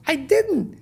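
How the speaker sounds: protesting innocence. Retold or imitated. Accused of taking everybody's lines, defensive protest. Acts out defending himself to colleague.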